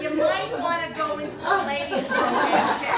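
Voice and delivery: loud, laughing gentle tip; laughing advice. Retold or imitated. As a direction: pass on the tip while laughing. Laughing while quoting woman.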